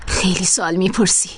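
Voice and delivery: low menacing whisper. Acted. Captor warns the girl off her questions, whispering. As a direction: hush her with a threat.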